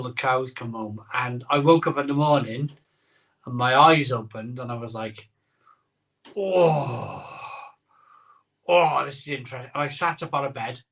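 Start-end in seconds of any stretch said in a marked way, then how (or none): 6.4-9.7 a pained, croaky waking-up groan; groggy hungover groan. Retold or imitated. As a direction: moan it like a man with a wrecked head.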